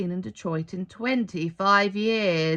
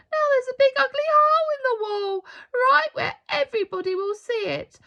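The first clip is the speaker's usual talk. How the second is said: put-on much higher, faster child's distressed voice; upset boy; 6 dB louder, faster than usual. A narrator's reading. Boy lamenting the ugly hole in the church wall, upset and fast. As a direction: blurt it out in dismay.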